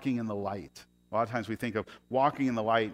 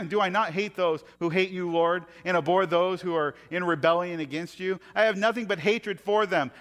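louder, higher intense psalm reading; reading scripture; 7 semitones higher, 6 dB louder than usual. Acted. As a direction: read it as a fierce prayer. Reads the darker psalm verses.

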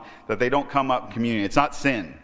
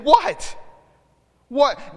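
sharp, high, louder What?; emphatic what; 10 semitones higher, 4 dB louder than usual. Acted. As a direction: throw the What? out as an emphatic rebuke. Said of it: "it's an emphatic statement".

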